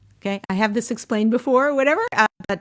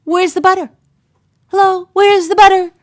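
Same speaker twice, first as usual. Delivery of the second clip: building from louder to much higher and louder; demanding nag; 8 semitones higher, 9 dB louder than usual. Retold or imitated. Wife nagging her husband about missing butter, rising indignation. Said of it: "And you're like".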